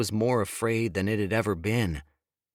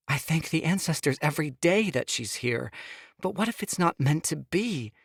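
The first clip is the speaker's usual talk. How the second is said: higher, pleading woman's voice; Sophia pleading; 6 semitones higher than usual. Acted. Wife begging husband to hear her, higher pleading. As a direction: plead with him, voice pitched up.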